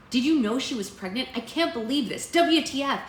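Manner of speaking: high, loud, incredulous outburst; gasping-gossip outrage; shocked friend. A narrator's reading. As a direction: react in loud disbelief. Acting out shocked friend's texts.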